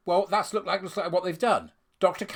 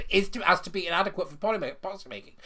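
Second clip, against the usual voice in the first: usual speaking voice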